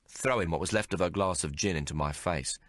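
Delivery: ordinary delivery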